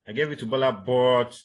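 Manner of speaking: "but" stretched loud on a steady pitch; level intonation. Shown as a demonstration. Teacher repeating example for students, level intonation before continuing. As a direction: hang on "but", sentence unfinished. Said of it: "that level".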